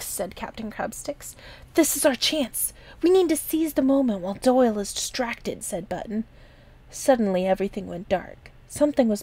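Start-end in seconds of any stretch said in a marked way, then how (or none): 1.7-5.6 put-on slightly raised, urgent young pirate; eager young pirate. A narrator's reading. Button urging the others to seize the moment, lightly voiced and eager. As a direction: press the plan on them eagerly.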